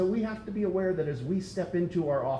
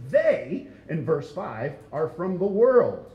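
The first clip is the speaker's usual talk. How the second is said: "They" punched high and loud; emphatic they; 3 semitones higher, 4 dB louder, slower than usual. Shown as a demonstration. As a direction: stress "They", then a slow, loud "are from the world". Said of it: "it says it emphatically".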